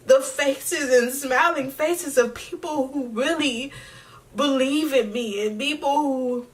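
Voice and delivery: real crying. Real, not acted. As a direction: push through the tears, grateful and moved. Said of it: "Y'all got me crying".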